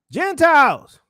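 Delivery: loud, high preacher's shout; calling out. Acted. Preacher summons the Gentiles as the heavenly voice, calling out. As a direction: cry out the word like a summons. Same call repeated.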